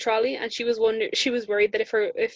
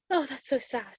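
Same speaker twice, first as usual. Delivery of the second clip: soft, higher, sympathetic dentist voice; mock gasp of pity; 5 semitones higher, 7 dB quieter than usual. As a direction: gasp then coo over the patient's bad luck. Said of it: "She was like".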